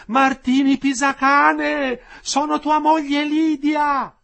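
high, long-held loud call; woman shouting out. A narrator's reading. Third wife calling her husband in prison, shouting. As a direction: make the names carry at full voice. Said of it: "E la terza".

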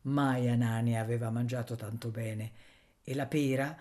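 ordinary delivery, nothing marked

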